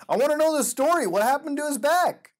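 high, loud demanding cry; frustrated wail. Real, not acted. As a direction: wail for the rest of the story. Frustrated that the story ends.

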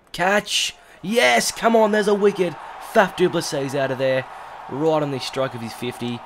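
high, loud shouting celebration; celebratory shout. Real, not acted. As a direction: yell with joy. Wicket taken, celebrating.

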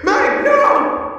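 high, loud cry of horror; horrified scream. Acted. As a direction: scream it as a friend falls. Friend being killed in the fight.

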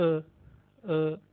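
"अ" short and unstretched. Shown as a demonstration. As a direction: clip "अ" short. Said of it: "ज़्यादा लंबा नहीं करना". ("अ" छोटा, लंबा नहीं)

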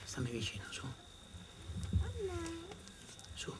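put-on calm the scared boy quietly; soft reassurance. Grandfather softly comforting his frightened grandson.

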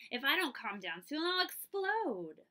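put-on a child about to boil over; building frustration. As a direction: let the pressure build until it bursts. Dolly about to lose her temper.

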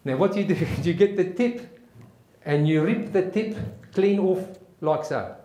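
emphatic South African military lecture; South African accent. Acted. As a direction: give step-by-step orders in a South African accent. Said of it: "try my best South African accent".

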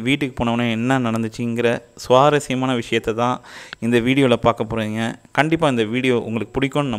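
muffled behind mask and shield; speaking under face shield; through a face mask. Real, not acted. Seen 1: Surgical mask covers mouth, plastic shield over face throughout; references show mask under chin.

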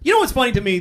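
normal talking voice

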